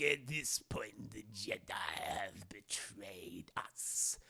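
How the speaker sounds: slow, hushed, low villain voice; Palpatine. Retold or imitated. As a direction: intone it ominously, word by word. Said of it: "Palpatine's voice changes here".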